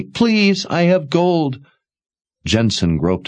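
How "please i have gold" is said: put-on high, urgent pleading young woman. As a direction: beg desperately. Jensen begs for a horse.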